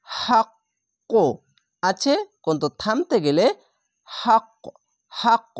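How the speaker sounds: হাক্ক with a bounced ক at the end; qalqala stop. Shown as a demonstration. Teacher repeats the qalqala stop for students. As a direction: knock the last ক of হাক্ক hard. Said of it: "এরকম বলে থেমে যাবেন".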